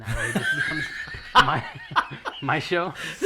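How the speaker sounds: laughing through the words; cracking up mid-sentence. Real, not acted. Laughing at his own joke.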